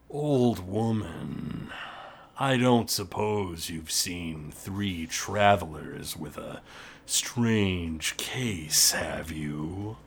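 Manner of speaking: low, slow, menacing orc voice; gruff orc boss. Acted. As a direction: growl it slowly through a door. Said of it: "the gruff voice of Lockgrim".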